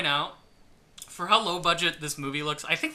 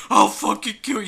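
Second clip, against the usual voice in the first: loud, higher threat; mock rage; 6 semitones higher, 8 dB louder than usual. Macon freaking out at Chris, in loud mock rage. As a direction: yell it like a furious brute. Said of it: "He's like".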